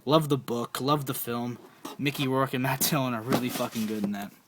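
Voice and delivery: ordinary talk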